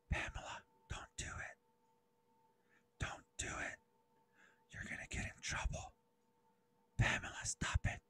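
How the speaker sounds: unvoiced warning whisper; conscience whisper. Conscience warning Pamela not to do it, whispered. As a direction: hush it like a voice inside her head. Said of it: "this is your conscience speaking".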